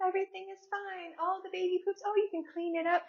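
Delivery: high, soft mock-sweet singsong; cutesy video voice. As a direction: parody a sugary baby video, high and soft. Mocking cute little parenting videos.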